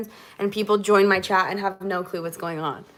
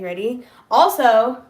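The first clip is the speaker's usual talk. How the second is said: ordinary delivery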